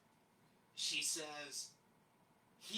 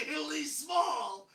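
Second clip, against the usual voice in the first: high, loud, stretched-out words; slow, loud delighted reveal; gleeful emphasis; 13 semitones higher, 12 dB louder, much slower than usual. Retold or imitated. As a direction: draw it out with rising glee. Retold tiny word spotted in picture.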